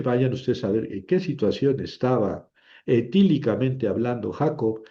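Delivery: usual speaking voice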